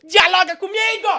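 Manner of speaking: loud, high, demanding yell at a silent cohost; mock shout. Streamer yells at muted cohost to get working, mock shout. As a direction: bark the order up high and loud.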